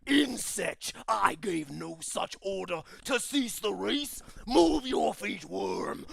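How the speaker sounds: loud, slow, thundering command; booming overlord. Acted. Pit lord booming at the racing slaves to move. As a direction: shake the foundations with every word. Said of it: "a voice that just shakes the foundation".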